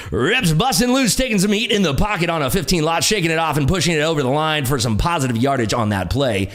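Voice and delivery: booming play-by-play announcer; broadcast voice. Pretend. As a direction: call it like a football broadcaster. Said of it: "read this in your best broadcast voice".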